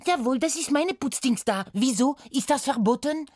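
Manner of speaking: hohe, trotzige Putzfrau mit französischem Akzent; französischer Akzent, trotzig. Acted. (Putzfrau verteidigt sich trotzig, französischer Akzent)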